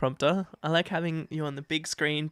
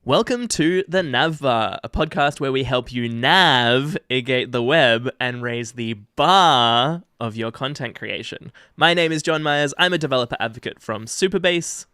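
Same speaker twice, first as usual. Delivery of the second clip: slow, bright announcer intro; formal welcome read; podcast intro read; 5 dB louder than usual. Acted. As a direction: open the show like a host.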